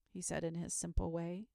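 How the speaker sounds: usual speaking voice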